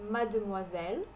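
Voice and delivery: mademoiselle said the French way, the D silent; French pronunciation. Shown as a demonstration. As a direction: mademoiselle as madmuazel, gliding past the D. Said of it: "é como se esse D fosse mudo". (mademoiselle em francês, sem som de D)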